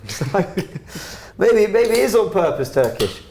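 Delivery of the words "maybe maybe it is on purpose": high, loud teasing delivery; sarcastic mock voice. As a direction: say it with mock seriousness. Sarcastic joke about Arteta.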